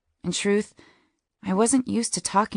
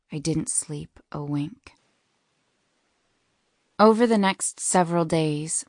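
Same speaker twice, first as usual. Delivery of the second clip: ordinary delivery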